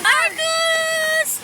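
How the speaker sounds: high excited call; cheering. Real, not acted. Cheering child on slide. (masiglang sigaw)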